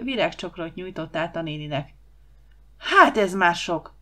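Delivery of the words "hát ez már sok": loud, slow indignant exclamation; fed-up outburst. Acted. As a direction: thunder it while banging the table. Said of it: "csapott az asztalra Huba".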